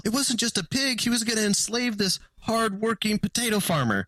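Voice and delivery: high-pitched, indignant justifying voice; indignant pig farmer. Retold or imitated. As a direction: squeak out the excuse like an offended farmer. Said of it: "And he's like, Well".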